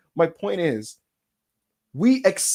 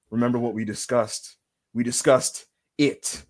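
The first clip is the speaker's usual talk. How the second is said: weighty pauses, mock-mysterious; The Rock; 7 semitones lower than usual. Wrestler reminding his ally of a secret, portentous. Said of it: "he's gonna be like".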